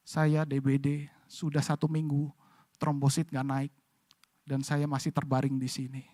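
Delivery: barely there, worn out by fever; weak sick patient. Retold or imitated. Sick patient answering from bed. (suara lemah orang sakit terbaring)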